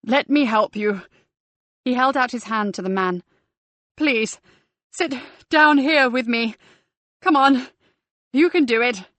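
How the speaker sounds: urgent coaxing. A narrator's reading. Guide coaxing a panicking man.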